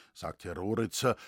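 usual speaking voice

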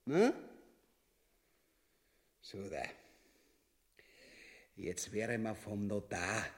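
normal talking voice

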